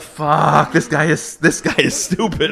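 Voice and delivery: high, loud, laughing; laughing disbelief. Retold or imitated. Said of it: "And we're like".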